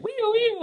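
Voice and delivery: high, loud mock police siren; siren imitation. Imitating the police siren as the cop pulls up. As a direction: wail it like a squad car.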